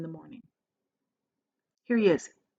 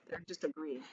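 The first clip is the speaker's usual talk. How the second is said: plain reading voice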